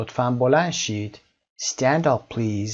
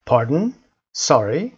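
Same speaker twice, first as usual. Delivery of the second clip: "Pardon" and "sorry" each swooping up at the end; question intonation; 4 dB louder, much slower than usual. Shown as a demonstration. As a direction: ask "Pardon" and "sorry" with a sharp rise. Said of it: "حالت سواله".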